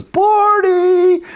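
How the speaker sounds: very high, far-carrying call of a name; distraught owner calling. Retold or imitated. Owner searching the trees, calling desperately for the lost parrot. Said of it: "very distraught about this with this".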